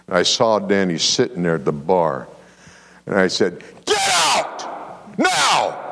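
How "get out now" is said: shouted order, pitched way up; furious command to leave; retold shout. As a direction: bark it at the man in the bar. Pastor orders Danny out of bar.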